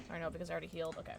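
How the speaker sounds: usual speaking voice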